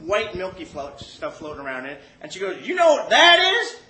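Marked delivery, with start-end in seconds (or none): 2.7-3.8 loud, high Arkansas holler; Arkansas accent. Retold or imitated. Toothless lady showing off a jar, Arkansas holler. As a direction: toothless Arkansas woman, hammed-up and piercing. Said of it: "like, she's from Arkansas".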